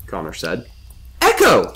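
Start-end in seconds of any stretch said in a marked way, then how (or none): 1.2-1.7 very high, loud excited echo. A narrator's reading. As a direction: pipe it up with excitement. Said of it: "the voice said excitedly".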